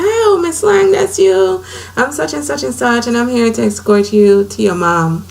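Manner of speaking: high, bright surprised greeting; airline lady greeting. Retold or imitated. Airline agent greets blind passenger off the plane, high cheerful voice. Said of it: "She said".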